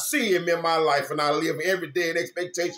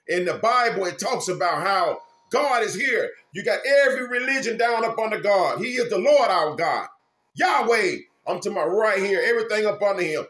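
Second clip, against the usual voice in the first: raised, driving preacher's voice; preaching at full force; 5 semitones higher, 4 dB louder than usual. Acted. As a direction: preach it out to the flock. Preaching to viewers.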